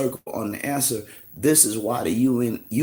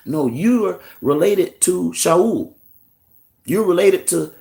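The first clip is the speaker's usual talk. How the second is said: loud, higher, emphatic mock preacher; insistent street preaching; 6 semitones higher, 6 dB louder than usual. Mimicking brothers on the corner telling people, loud and insistent.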